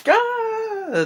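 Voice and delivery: a very high, short falsetto "Ah!" shriek; mock scream. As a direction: shriek like a startled heroine. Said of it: "Kim Basinger's like".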